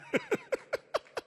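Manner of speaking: bursts into a high, quiet laugh; chuckling at his own joke to the audience. Real, not acted. Soldier laughs at his own joke about spicy stories.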